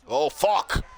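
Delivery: sudden angry yell at the screen; real shout. Real, not acted. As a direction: blurt it out loud in frustration. Reacts to a bad play.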